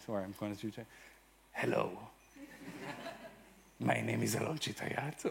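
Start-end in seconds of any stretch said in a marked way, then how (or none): 1.5-5.2 lightly put-on Spanish accent, a bit lower and softer; a tentative Spanish accent, half-embarrassed. Shown as a demonstration. Speaker introducing himself to the audience in a mild Spanish accent. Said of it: "I'm gonna do the, the Spanish, uh, accent".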